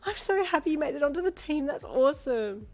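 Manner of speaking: smiling. A narrator's reading. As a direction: gush through a smile. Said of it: "you said with a smile".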